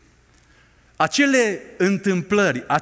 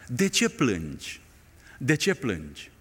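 tender voice of God; 6 semitones lower, 6 dB quieter than usual. Acted. God asks the weeper.

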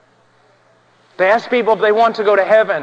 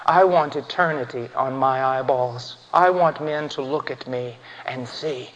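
low, hushed and weighty; subdued, low pulpit solemnity; solemn preaching; 3 semitones lower, 4 dB quieter than usual. Real, not acted. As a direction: preach gravely in a soft, deep register with long pauses.

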